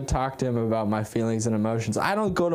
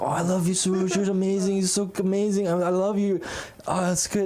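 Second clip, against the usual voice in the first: higher-pitched gushing grandpa impression; doting grandfather; 5 semitones higher than usual. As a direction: coo praise as an adoring grandfather. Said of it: "my grandfather's the cutest man in the world".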